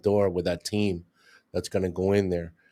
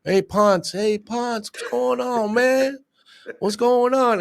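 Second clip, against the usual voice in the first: calling from a distance; slower than usual. Retold or imitated. Locals calling out to the detective from afar, high-pitched call. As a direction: hail him from far off, pitched way up. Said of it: "everybody's shouting your name from a distance".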